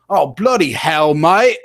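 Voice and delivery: exaggerated British exclamation, rising on the last word; put-on British accent. Shown as a demonstration. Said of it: "stuff that I like to do in British".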